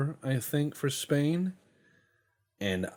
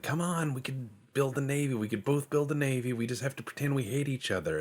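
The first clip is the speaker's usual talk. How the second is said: higher, quick persuasive coaxing; conspiratorial pitch; 7 semitones higher, faster than usual. Retold or imitated. Spain coaxing Argentina into a fake rivalry. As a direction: talk them into the scheme. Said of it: "I'll be like".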